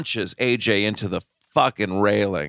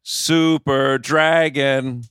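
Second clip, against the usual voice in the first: drawn-out name; 4 semitones higher, 3 dB louder, much slower than usual. Real, not acted. Slow reverent name answer.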